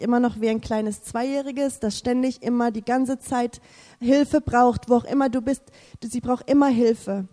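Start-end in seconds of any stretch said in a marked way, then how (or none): none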